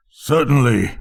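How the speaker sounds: ordinary delivery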